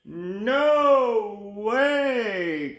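high, long-drawn refusal; hammed-up cry, both words stretched. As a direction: wail it as panicky cartoon crabs. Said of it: "cried the crabs".

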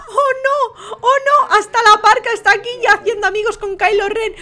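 high, dramatic dismay; shocked exclaiming. Real, not acted. Host sees the Parca making friends, shocked exclaiming. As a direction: cry out in disbelief.

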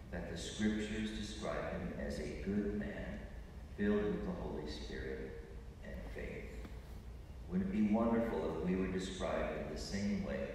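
ordinary talk

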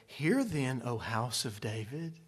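low, soft, solemn scripture reading; churchy reading. Shown as a demonstration. Preacher showing how we read it in church, subdued. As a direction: read it dutifully and quietly, voice dropping low. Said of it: "This is the way we read it".

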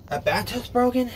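high, rising disbelief; disbelieving question. Real, not acted. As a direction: question it in high-pitched dismay. Finds his bathtub broken.

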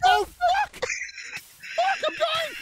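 high frantic yelling; panicked scream. Real, not acted. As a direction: panic as you die. Dying in horror game.

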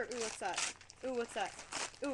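usual speaking voice

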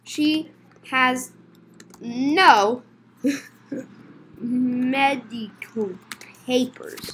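slow, broken-up statement; slow word by word. Acted. Reporting the customer's missing papers, very slowly. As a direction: leave long gaps between the words.

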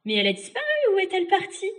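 loud put-on puzzlement, rising high; feigned bewilderment. Boy ignoring the witch's ruse, loudly feigned surprise. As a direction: pretend you haven't seen through it. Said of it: "feignit d'ignorer la ruse et dit".

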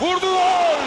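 shouted goal call; screaming football commentator. Real, not acted. Goal call in penalty shootout.

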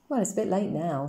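ordinary delivery

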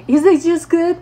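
high, loud, hammed-up fussing; awkward over-eagerness. Speaker to the rapper about his juice, awkwardly eager. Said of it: "the way I said it was just so".

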